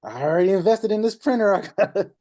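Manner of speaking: high, panicky rushed pleading; nervous newbie. Retold or imitated. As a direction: play the desperate beginner who needs the money. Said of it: "you start getting nervous".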